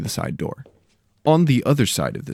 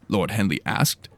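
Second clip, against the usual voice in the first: ordinary delivery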